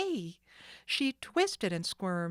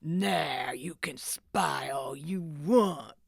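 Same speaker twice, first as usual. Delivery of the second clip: slow low mocking sneer; mock her through the door; 3 semitones lower, 3 dB louder, slower than usual. Sid locks Nancy in, sneering slowly. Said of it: "Sid sneered".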